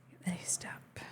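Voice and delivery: soft whisper to self. Real, not acted. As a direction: whisper it under your breath. Muttering while grabbing the list.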